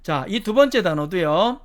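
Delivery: ordinary delivery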